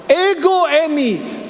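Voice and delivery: high, slow proclamation of Ego eimi; commanding divine voice. Acted. Jesus to the soldiers, slow and commanding. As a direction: let each word fall with power.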